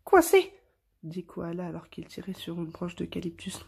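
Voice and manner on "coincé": cri agacé, aigu et fort; lance-le sèchement, pris au piège; un peu moins amical. A narrator's reading. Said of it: "d'un ton un peu moins amical". (exclamation bourrue et aiguë)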